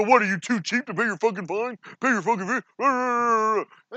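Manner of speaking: sneering, drawn-out imitation of a bullying collector; mock bully extortionist. Mocking ASCAP's reply to a complaining venue owner, sneering. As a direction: mimic a smug extortionist demanding payment. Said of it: "they go into their fucking bully extortionist mode".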